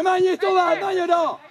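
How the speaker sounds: high, loud sideline order; urging shout. Real, not acted. Coach tells player to keep going, urging shout. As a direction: yell to drive the player on.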